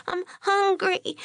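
put-on slow, high-pitched child's whimper; tearful little girl. A narrator's reading. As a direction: whine slowly like a sniffly small child. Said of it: "hiccuping little sobs".

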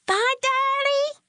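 put-on high, slow little girl calling out; a three-year-old hollering goodbye to her daddy; loudest toddler voice. A narrator's reading. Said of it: "hollered in her loudest three-year-old voice".

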